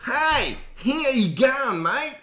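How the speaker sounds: put-on high, hammy Australian accent; bad Australian accent. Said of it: "Or worse".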